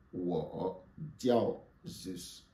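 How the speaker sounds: spoken very slowly, syllable by syllable; slow model phrase. Shown as a demonstration. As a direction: say it slowly for learners to repeat. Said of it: "Répétez après moi". (lentement, syllabe par syllabe)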